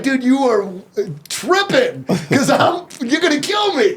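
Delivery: disbelieving reply to coaches; incredulous. Retold or imitated. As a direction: tell them they're tripping. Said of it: "I was like".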